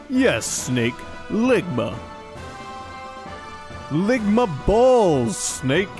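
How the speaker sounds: hammy villain gloat, dragged out; Liquid Snake voice. Acted. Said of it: "I already kinda did give him a liquid voice".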